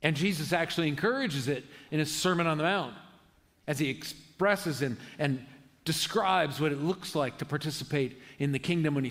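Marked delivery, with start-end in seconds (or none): none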